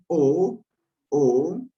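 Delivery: "O" said slowly, lips rounded for O but voicing A. Shown as a demonstration. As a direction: stretch it out with the mouth rounded. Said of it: "automáticamente digo la A".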